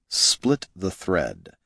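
slow, quiet instruction; each word calm and deliberate; slow command. Acted. Mentor gives a calm order.

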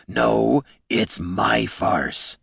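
very slow, drawn-out troll growl. A narrator's reading. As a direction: growl it slowly, insisting it's yours. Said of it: "growled the troll".

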